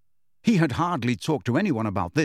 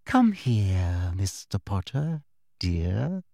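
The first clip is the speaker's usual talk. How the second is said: put-on sweetly girlish voice; 4 semitones lower, much slower than usual. A narrator's reading. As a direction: coo it like a falsely sweet teacher. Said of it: "in her softest, most sweetly girlish voice".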